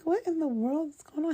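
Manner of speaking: high, bewildered disbelief; a high, stunned what-in-the-world; incredulous. Retold or imitated. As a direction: ask it like you can't believe this is happening. Her own shocked reaction, quoted.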